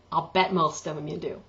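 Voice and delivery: ordinary talk, nothing marked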